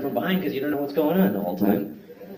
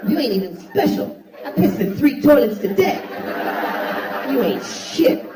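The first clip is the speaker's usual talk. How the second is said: high, far put-on voice hurling insults; shrill mock bully voice; high squeaky taunting voice; 11 semitones higher than usual. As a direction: squeal insults at the toilet through laughter.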